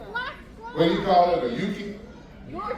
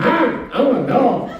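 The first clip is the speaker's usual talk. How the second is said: hammed-up raspy-voiced dog in an ad; dog in anti-smoking ad; 5 semitones higher, 4 dB louder than usual. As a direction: play the sick dog pleading in a public ad. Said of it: "He's doing commercials".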